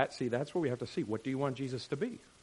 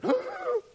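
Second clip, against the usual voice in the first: sharp high mock gasp; 4 dB louder than usual. Reaction to forgiveness.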